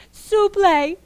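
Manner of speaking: high, pleading s'il vous plaît; begging. Acted. Thirsty cow pleading with the guardian, high and begging. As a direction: beg on your knees for it. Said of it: "she started to beg saying".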